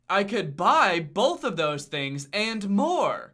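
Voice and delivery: hammed-up dawning revelation, swooping up at first then slowing down; mock sudden realisation. Reviewer imagines the movie's hero finally thinking of money, mock realisation. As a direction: play a dim guy just discovering money.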